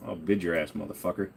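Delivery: ordinary talk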